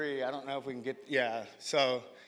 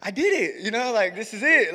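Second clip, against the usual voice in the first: higher, bursting with news on the phone; excited; 6 semitones higher than usual. Retold or imitated. Calling his wife from the car, excitedly higher. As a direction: announce it like you just won. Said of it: "I'm all excited".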